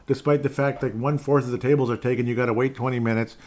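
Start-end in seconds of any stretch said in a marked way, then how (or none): none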